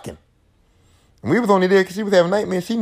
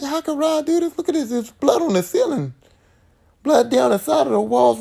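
mock horrified girl; 8 semitones higher than usual. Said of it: "Nancy looking at like".

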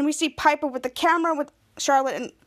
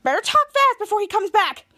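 high-pitched, pressing warning; urgent prompting; 7 semitones higher, 4 dB louder than usual. Retold or imitated. Bianca next to Henry before Jasper returns, urgent prompting. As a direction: urge him on before the interruption.

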